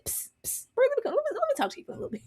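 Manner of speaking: hurried furtive whisper; sneaky retold whisper, rattled off; acted whisper. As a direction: hiss for attention, then whisper very fast. Acting out a secret aside.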